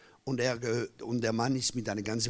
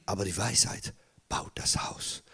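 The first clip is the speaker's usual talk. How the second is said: whisper; 7 semitones lower, 5 dB quieter than usual. Real, not acted. Preacher whispering a proverb to the congregation. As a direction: whisper the wisdom line to the church.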